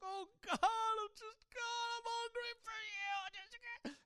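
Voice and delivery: loud, high-pitched imitated wail; hammed-up desperate cry of prayer; crying out to God. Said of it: "I'm just crying out to God".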